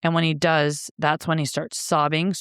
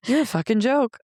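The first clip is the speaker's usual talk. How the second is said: laughing at self; 6 semitones higher than usual. Retold or imitated. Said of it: "I started laughing 'cause I was like".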